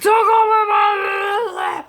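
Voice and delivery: put-on high, drawn-out drunken wail; freaked-out drunk shout. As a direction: imitate a drunk girl freaking out loudly. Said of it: "Freaked out".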